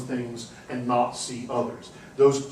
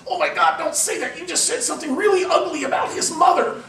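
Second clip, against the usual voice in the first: much higher, louder, urgent warning voice; alarmed friend; 6 dB louder than usual. Retold or imitated. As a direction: play the horrified friend stopping a faux pas. Friend alarmed at a Chinese blunder.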